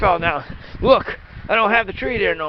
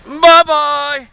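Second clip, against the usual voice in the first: cheery goodbye; 6 semitones higher, 9 dB louder than usual. Real, not acted. Cheery sign-off.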